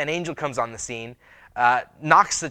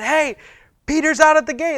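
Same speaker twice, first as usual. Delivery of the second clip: high, loud acted cry of news; Rhoda's excited announcement; 13 semitones higher, 8 dB louder than usual. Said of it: "she's so excited".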